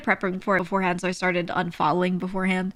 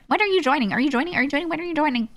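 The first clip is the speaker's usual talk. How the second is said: raised, insistent nagging voice; pestering question; 7 semitones higher, faster than usual. Retold or imitated. People pestering the streamer daily, insistent and raised. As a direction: nag her over and over, a bit higher.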